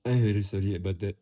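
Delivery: clumsy attempt at a French accent; failed French accent. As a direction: have a poor go at sounding French. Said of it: "don't even know what a French accent sounds like".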